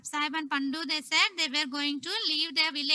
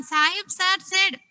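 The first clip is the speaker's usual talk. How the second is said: ordinary delivery, nothing marked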